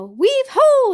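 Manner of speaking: high, loud cheer; cheerful cry. A narrator's reading. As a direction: sing it out like eager spiders. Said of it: "said the ten".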